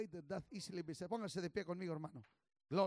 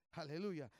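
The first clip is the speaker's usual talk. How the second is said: usual speaking voice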